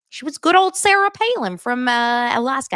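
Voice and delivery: put-on a light, high Sarah Palin Alaskan accent. Vlogger attempts Sarah Palin's Alaskan accent, high and light. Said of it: "I can't even do her accent".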